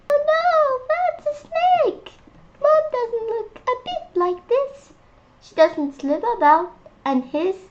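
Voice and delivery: high monkey voice saying no; little monkey voice. Acted. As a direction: protest like a small stubborn monkey. Monkey rejects snake.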